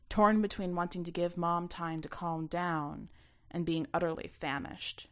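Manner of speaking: plain reading voice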